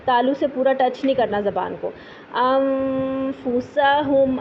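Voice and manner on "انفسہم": انفسہم with long nasal hum before fa; ikhfa recitation. Shown as a demonstration. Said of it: "زبان نیچے رہے گی".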